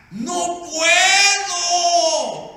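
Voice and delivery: very long, high, loud wail; hammed-up drawn-out howl; anguished refusal. Husband unable to forgive his wife, anguished.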